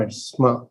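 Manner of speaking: "sma" said as one syllable; no vowel between s and m in "sma"; pronunciation correction. Shown as a demonstration. Teacher correcting the reader, "sma" as a single syllable. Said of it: "it's not sama".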